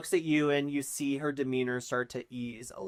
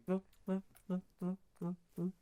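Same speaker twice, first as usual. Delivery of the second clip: higher and much softer, hushing each one; soothing murmur; 5 semitones higher, 9 dB quieter, faster than usual. Acted. Lady Isolde hushing each adventurer, soft and soothing. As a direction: coo it softly to each stranger. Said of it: "Her voice ... is very reassuring".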